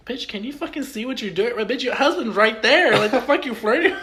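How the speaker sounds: high, loud exasperated rant; outraged inner rant. Retold or imitated. Tony watches the cashier flirt beside her husband, outraged. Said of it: "I'm like".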